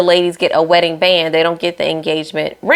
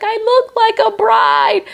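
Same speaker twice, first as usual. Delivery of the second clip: higher, slow, hammed-up bride in awe; mock teary bride. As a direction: stretch it out like she's overwhelmed. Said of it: "she's like".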